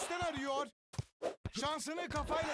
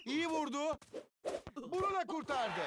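ordinary talk, nothing marked

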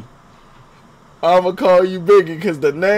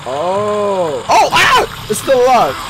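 high, loud panicked shrieks; real jump scare yelp; startled scream; 6 semitones higher, 4 dB louder, slower than usual. Real, not acted. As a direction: jump out of your skin. Enemy revives, startled.

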